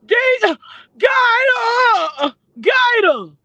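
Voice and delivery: high, mock-wailing "Gator", imitating the rival; mocking her voice. Imitating the rival's crying call on live, high and mocking. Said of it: "Who sound like that?".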